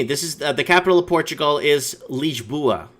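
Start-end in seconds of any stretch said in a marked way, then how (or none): 2.1-2.9 Lisboa said "leezh-BOO-uh"; Portuguese pronunciation. Shown as a demonstration. Host teaching the listener Portugal's capital, Portuguese pronunciation. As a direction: zh sound for the s in Lisboa. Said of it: "repeat after me".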